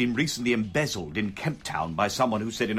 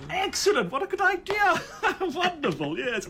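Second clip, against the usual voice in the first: very high, delighted squeal with laughter; gushing feigned enthusiasm. Acted. Listener pretending to follow the new system plan, gushing high. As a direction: gush as if you completely get it.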